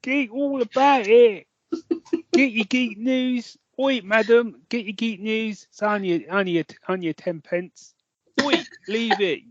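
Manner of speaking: high-pitched hawking patter; newspaper seller. Acted. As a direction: call out to passers-by like a paperboy. Plays newspaper seller hawking geek news.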